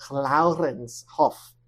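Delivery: "Laurens Hoff" tried slowly the Dutch way; attempted Dutch pronunciation. Shown as a demonstration. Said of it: "don't even know if I could pronounce it".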